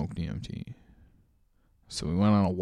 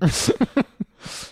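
breathy laugh; 13 semitones higher, 6 dB louder than usual. Real, not acted. Laughs after herb joke.

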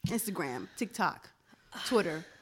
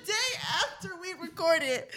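laughing through words; 7 semitones higher, 5 dB louder than usual. Real, not acted. Laughing while telling.

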